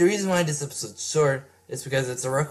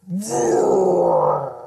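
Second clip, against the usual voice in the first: monster roar; 3 semitones higher than usual. Acted. Hulk roaring in battle.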